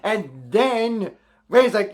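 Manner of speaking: ordinary talk, nothing marked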